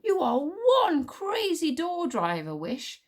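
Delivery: slow, high swooping admiration; grudgingly impressed, drawing out the praise; reluctant respect. A narrator's reading. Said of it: "said Tsar with reluctant respect".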